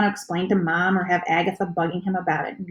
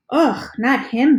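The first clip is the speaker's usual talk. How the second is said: snorting; 6 semitones higher, 6 dB louder, slower than usual. A narrator's reading. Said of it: "Ben snorts".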